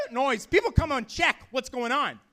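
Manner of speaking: ordinary talk, nothing marked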